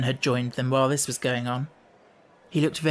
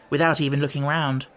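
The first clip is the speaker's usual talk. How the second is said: plain reading voice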